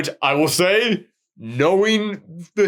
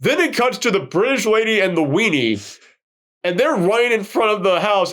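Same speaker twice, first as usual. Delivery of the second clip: ordinary talk, nothing marked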